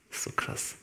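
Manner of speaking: whispered in amazement; awed whisper. Real, not acted. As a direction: breathe it out, almost voiceless. Staunender Einschub in Predigt. (staunend geflüstert)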